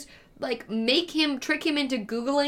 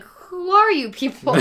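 higher, louder, slow baffled cry; mock outrage at strangers; drawn-out who are you people; 7 semitones higher, 7 dB louder, slower than usual. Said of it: "And then I was like".

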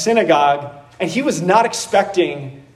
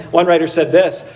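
ordinary delivery

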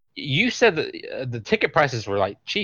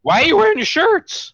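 high, incredulous mock yell; mock questioning; 4 dB louder than usual. Joking about quizzing shirtless fans, mock questioning. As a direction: pretend to grill the fans at a high pitch.

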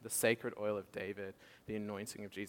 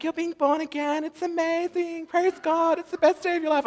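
high and louder, gushing praise; excited Jen; 12 dB louder than usual. Retold or imitated. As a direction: bubble over with joy for her friend. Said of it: "And Jen's like".

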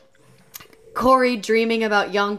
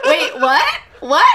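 louder, higher, laughing what; baffled, higher and louder while laughing; laughing disbelief; 6 semitones higher, 7 dB louder, slower than usual. Retold or imitated. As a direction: blurt confused through laughter. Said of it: "And I went".

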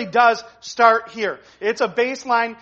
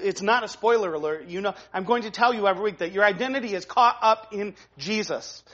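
ordinary talk, nothing marked

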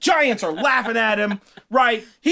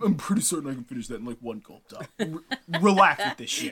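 cocky Thor; 5 semitones lower, 4 dB quieter, faster than usual. Acted. Thor boasts casually.

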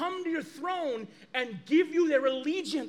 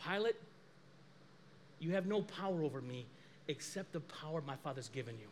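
lower, soft and steady; calm quiet Christ; 8 semitones lower, 11 dB quieter than usual. Retold or imitated. Christ calmly answering Pilate, low and soft. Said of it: "And Christ says".

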